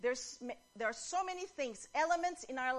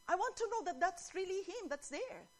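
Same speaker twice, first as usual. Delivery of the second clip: pleading fan; 8 semitones higher than usual. Retold or imitated. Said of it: "that person even wanted to go".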